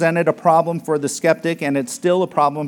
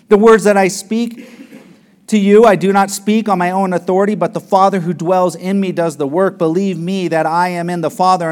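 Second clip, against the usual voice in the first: loud, ringing scripture reading; preaching scripture at full force; 3 semitones higher, 5 dB louder than usual. Acted. Preacher booming Jesus' promise to the disciples, full force. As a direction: proclaim it to the congregation.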